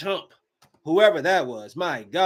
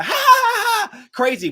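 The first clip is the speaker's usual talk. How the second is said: mock maniac laugh; 4 dB louder than usual. Mocking the wild Joker image.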